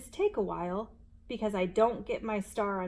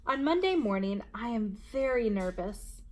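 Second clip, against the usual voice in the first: ordinary delivery, nothing marked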